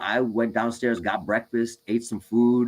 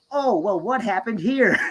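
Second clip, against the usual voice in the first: high, lightly voiced sing-song nurse; a hint of a chirpy nurse; funny nurse voice; 10 semitones higher, slower than usual. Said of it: "nurses and, like, talking funny".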